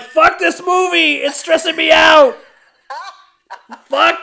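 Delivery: high, loud, slow outburst; stressed shout. Retold or imitated. Watching the movie, a high loud stressed-out shout. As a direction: yell it high and slowly, fed up. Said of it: "And I'm like".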